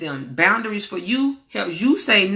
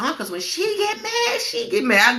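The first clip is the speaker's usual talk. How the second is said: much higher, mock-dramatic family voice; teasing relatives; 11 semitones higher than usual. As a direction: play the relatives teasing her about her temper. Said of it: "They be like".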